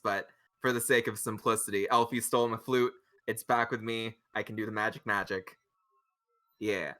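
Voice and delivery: ordinary talk, nothing marked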